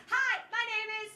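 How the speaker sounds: high sing-song title call; playful title announce; announcer lilt. Acted. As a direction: pitch it up like a showman.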